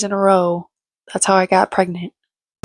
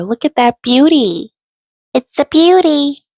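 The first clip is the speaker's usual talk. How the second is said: louder, higher, gushing over the result; excited showing off; 8 semitones higher, 5 dB louder than usual. Retold or imitated. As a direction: gush over it with delight.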